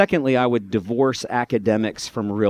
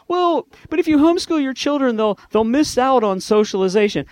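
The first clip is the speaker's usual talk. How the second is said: mocking critic; 11 semitones higher than usual. Mocks critics of homeschooling.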